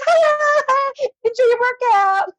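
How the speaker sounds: high-pitched mocking kid voice; gloating prankster kids. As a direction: imitate children laughing and taunting in a high voice. Acts out kids gloating over prank.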